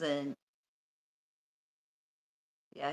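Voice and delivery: ordinary talk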